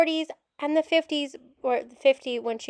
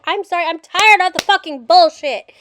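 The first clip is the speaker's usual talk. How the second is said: frustrated yell; 4 semitones higher, 9 dB louder than usual. Real, not acted. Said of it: "And I'm gonna yell".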